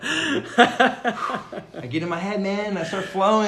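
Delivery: laughing. Real, not acted. Laughing at his own rambling.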